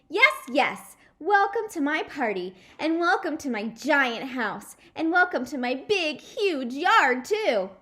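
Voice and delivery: high, loud, showing-off gush; bragging girl. Acted. Lucille to her classmates, a loud bragging welcome. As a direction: crow about everything you own.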